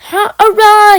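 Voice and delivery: high, loud joyful cheer. A narrator's reading. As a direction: shout for joy. Engine cheers at summit.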